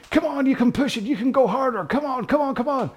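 high, insistent American pep talk; mock American coach. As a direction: egg them on in a put-on American voice. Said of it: "Don't know why I'm being American".

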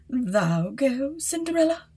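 lightly voiced, mocking stepmother; a hint of a contemptuous stepmother; scornful stepmother. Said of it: "she said".

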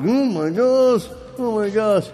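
high, loud, drawn-out dismay; mock alarm. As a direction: re-enact freaking out, stretching it out. His alarmed reaction retold.

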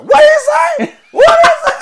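very high, loud, laughing shriek; grandma shrieking at the cartoon; shocked grandma shout. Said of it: "she was like".

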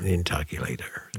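low, soft mock-grand title; mock character voice. Host playing the intoculator, low mock character voice. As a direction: drop low and quiet for the title.

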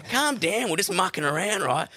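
shrill high panicked protest; startled alarm. Retold or imitated. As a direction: yelp it, shocked he's attacking. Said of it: "And I was like".

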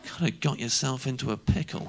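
low, subdued inner voice; sobered realisation. Retold or imitated. Drunk man suddenly realising he is cornered, quiet and low. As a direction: say it softly to yourself, deflated.

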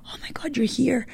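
high, breathless whisper; stunned disbelief. Retold or imitated. Mother suddenly seeing her daughter back, whispered disbelief. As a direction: whisper it as if you can't believe your eyes. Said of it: "in disbelief".

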